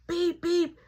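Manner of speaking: two high beeps; imitated car horn. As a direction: honk it in a high voice. Imitates horns in the traffic.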